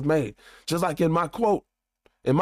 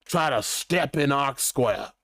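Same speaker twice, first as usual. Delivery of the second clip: lower, slow mock indignation; indignant big company; 4 semitones lower, slower than usual. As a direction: lightly act an affronted giant, drawn out. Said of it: "they like".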